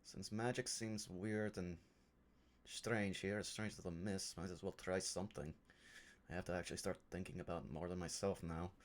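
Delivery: low, soft private muttering; hushed, pondering aside; musing to oneself. Acted. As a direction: think aloud, quietly and to yourself. Character thinks aloud by the grave.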